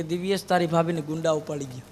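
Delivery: low, soft mournful friend; depressed friend moaning about a problem; gloomy lament. Retold or imitated. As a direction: sigh it out like a man in trouble. Gloomy friend, head in hand.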